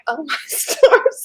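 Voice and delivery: slow words broken up by real laughter; laughing at the friends who won't leave, slowly. Real, not acted. Mom laughing at kids who crashed the family meeting. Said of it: "I'm laughing 'cause I go".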